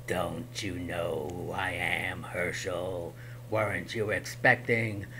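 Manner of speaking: low, slow eerie whisper; menacing goblin king; monster whisper. Acted.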